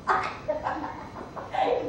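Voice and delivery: soft, airy snake chuckle; quiet laugh at the victim's expense; sly, acted snicker; gloating laugh. Snake gloats over tricking man.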